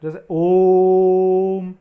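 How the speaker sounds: ओम को बहुत लंबा खींचकर, ज़ोर से गाते हुए; ओम को तीन मात्रा तक देर तक गूंजाएं; प्लुत ओम. Shown as a demonstration. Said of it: "उसको उच्चारित करते समय हमें अधिक समय लेना है". (प्लुत ओम, धीमा और लंबा)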